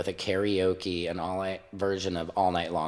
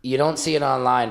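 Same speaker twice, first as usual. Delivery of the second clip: usual speaking voice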